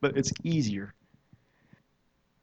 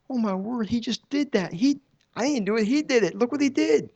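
very high, loud, astonished praise; amazed exclamation; 11 semitones higher, 5 dB louder than usual. Retold or imitated. Realizing the boy was healed, high and amazed. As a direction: exclaim in wonder, climbing higher. Retold amazement at answered prayer.

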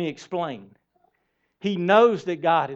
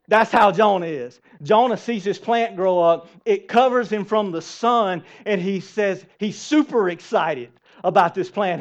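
usual speaking voice

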